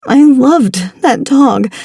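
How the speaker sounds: slow, tearful and choking; choked up. A narrator's reading. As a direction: choke the words out through tears. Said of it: "I choked out".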